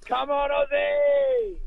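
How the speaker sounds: drawn-out supporter's shout; cheering shout. Real, not acted. Caller in Bali signing off, cheering the Aussies. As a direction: holler it slowly for your team.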